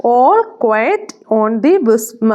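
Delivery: dictating a title word by word; very slow dictation. Shown as a demonstration. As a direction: stretch each word out for notes. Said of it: "pronunciation എന്തെങ്കിലും വ്യത്യാസം".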